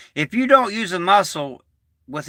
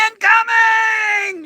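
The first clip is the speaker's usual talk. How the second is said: high, drawn-out yell of "incoming"; mock alarm yell; much slower than usual. Veteran reacting to a big pop, mock alarm yell. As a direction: holler it long and high like a mock warning.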